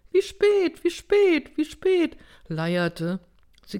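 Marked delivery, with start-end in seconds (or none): 0.1-2.2 very high, monotone chant; loud, high, unvarying singsong; droning repetition. A narrator's reading. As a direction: repeat it like a stuck prayer wheel. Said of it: "gebetsmühlenartig ... leierte".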